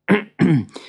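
small preparatory cough; clearing the throat. A narrator's reading. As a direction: clear your throat before reciting. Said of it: "Simeon gave a cough".

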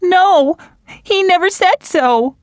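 anguished cry. Acted. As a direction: cry out the no in pain. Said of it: "cried Marianne".

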